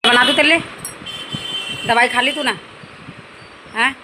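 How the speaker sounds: a mother calling to her sick daughter, loud and high. Real, not acted. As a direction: raise your voice so the daughter hears.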